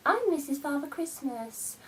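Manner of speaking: high, prissy mock of mum. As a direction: sneer it like her smug mother. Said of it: "still goes around saying".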